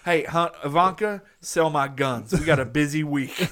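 put-on loud hillbilly accent, Trump's voice; hillbilly accent, loud and cocky. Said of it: "I don't know why I'm still in a hillbilly accent".

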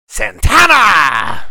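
slow, drawn-out hype announcement; announcer intro. Acted. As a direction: boom it out like a ring announcer. Introducing the guest dramatically.

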